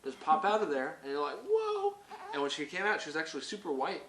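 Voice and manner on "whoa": high, amazed exclamation; amazed whoa. Retold or imitated. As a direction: gasp at the sight. Seeing the baby's head.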